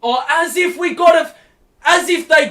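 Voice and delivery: high, loud, disbelieving complaint; real indignant outburst; outraged protest. Real, not acted. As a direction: protest the call at the ref. Outraged at technical foul.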